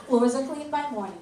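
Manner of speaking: normal talking voice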